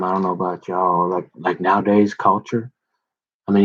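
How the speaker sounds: normal talking voice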